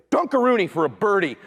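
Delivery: usual speaking voice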